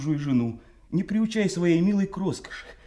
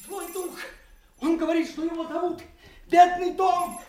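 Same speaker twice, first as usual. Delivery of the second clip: seething shrieking spirit; 14 semitones higher, 3 dB louder than usual. Acted. Fool shrieks about the spirit.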